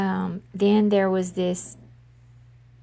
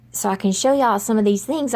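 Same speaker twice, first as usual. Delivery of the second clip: quicker, with less country accent; talking faster; 2 semitones higher, 4 dB louder, faster than usual. Real, not acted. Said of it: "try to talk faster and less of my country accent".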